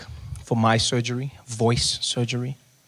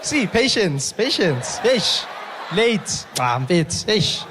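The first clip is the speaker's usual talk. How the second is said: mock exasperated; 7 semitones higher, slower than usual. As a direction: tease the latecomers for being late. Mock annoyed host, latecomers.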